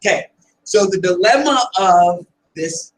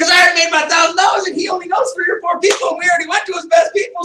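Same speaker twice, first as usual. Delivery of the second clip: excited rant; 7 semitones higher, faster than usual. Real, not acted. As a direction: hammer the point home with energy. Speaker's heated punchline.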